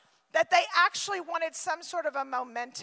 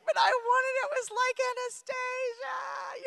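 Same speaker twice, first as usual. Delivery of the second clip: whiny daughter; 7 semitones higher, 4 dB quieter than usual. Retold or imitated. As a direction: beg like a child denied. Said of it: "She's like".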